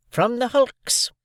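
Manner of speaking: high, slow, stern; sister. A narrator's reading. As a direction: answer curtly, drawn out. Sister answers.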